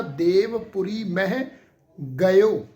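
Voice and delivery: ਗਇਓ said loudly as ga-yo; correct pronunciation. Shown as a demonstration. Teacher demonstrating ਗਇਓ, loud and slow. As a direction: hit ਗਇਓ loud and clear after a slow ਦੇਵਪੁਰੀ ਮਹਿ. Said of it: "ਗਇਓ ਪਾਠ ਆਏਗਾ".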